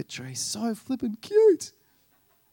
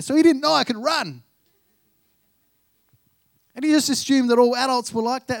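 excited punchline; 6 semitones higher, 7 dB louder than usual. Real, not acted. Punchline about the son's belief.

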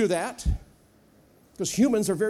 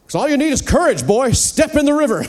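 loud stern retort, higher; loud impatient God; booming God; 5 semitones higher, 10 dB louder than usual. Pretend. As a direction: thunder it back as God.

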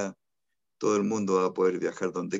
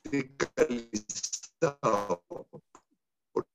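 glitching audio; 4 dB quieter, much slower than usual. Real, not acted. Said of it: "Ahí se cortó un poquito".

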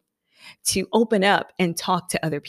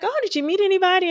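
eager question; 12 semitones higher, much faster than usual. Retold or imitated. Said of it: "I was like".

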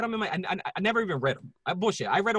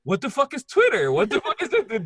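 loud, high mock bewilderment; raised-voice retold confusion with a laugh; baffled outburst; 7 semitones higher, 7 dB louder than usual. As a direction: blurt it, totally clueless.

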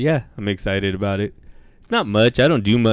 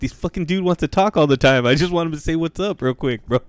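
a higher, fed-up grumble about David; mocking the worker; 8 semitones higher than usual. Worker complaining to a coworker about David, higher mock voice. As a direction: play the worker complaining to someone else. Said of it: "He's like".

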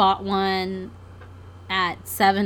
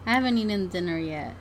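usual speaking voice

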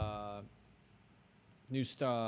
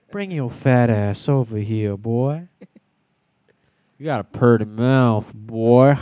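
creepy character voice; 13 dB louder, slower than usual. Acted. Topping the creepy impressions for friends, loud put-on voice. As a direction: belt it out as a hammed-up leering creep.

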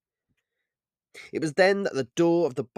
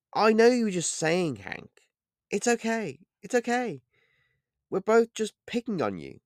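higher light woman's voice, teasing; Holly, smiling; 11 semitones higher than usual. A narrator's reading. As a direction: soothe him with a smile. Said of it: "replied Holly with a smile".

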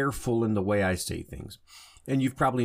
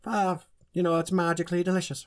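high squeaky mock Irish leprechaun voice; cereal-ad leprechaun, pitched far up; 10 semitones higher than usual. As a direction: ham up a cartoon leprechaun, way up high. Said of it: "I'm not gonna make me voice up high".

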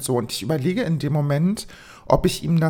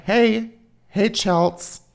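mild mock call from behind, higher and drawn out; nachgeahmtes Rufen; 4 semitones higher, much slower than usual. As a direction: imitate someone calling after you, held back. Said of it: "wie jemand von hinten schreit". (gedämpft nachgeahmtes, gedehntes Rufen)

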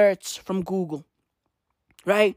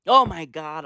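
ordinary delivery

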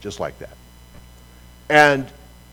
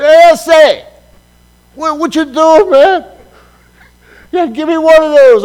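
high, loud street-guy holler across the road; loud, high mock homeboy call; 14 dB louder, slower than usual. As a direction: hail them from across the street. Street guys calling across street.

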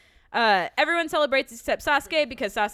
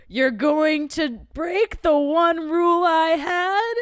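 loud, slow, higher threatening question; menacing demand; 6 semitones higher, 11 dB louder, much slower than usual. Retold or imitated. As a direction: loom over them, drawing it out. Kakashi confronts the kids.

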